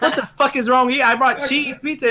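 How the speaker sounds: high, laughing outrage; laughing indignation. Retold or imitated. Worker laughing while retelling his coworkers' jab.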